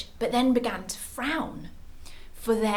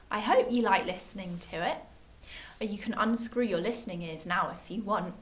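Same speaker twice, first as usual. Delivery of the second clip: ordinary delivery, nothing marked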